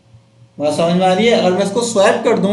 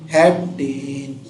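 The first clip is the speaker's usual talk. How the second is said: drawn out slowly; 5 semitones lower, 4 dB quieter, much slower than usual. Shown as a demonstration. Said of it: "आगे क्या आ जाएगा?".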